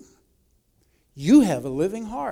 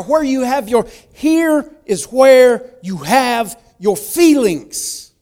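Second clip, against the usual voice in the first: rising, louder preacher's cry, slowing on the last line; impassioned preaching; 5 semitones higher, 6 dB louder than usual. Acted. Preacher to congregation about joy, building to loud passion. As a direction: preach it from the core, full voice.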